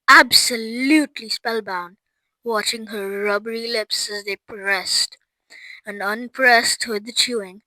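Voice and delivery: ordinary delivery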